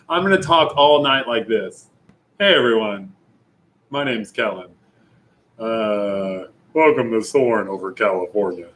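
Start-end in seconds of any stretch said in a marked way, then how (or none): 2.4-8.7 lightly voiced, lower theme park host; a hint of the Soarin' ride guide welcoming riders; mock welcome spiel; ride announcer impression. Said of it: "I need to get that voice down better".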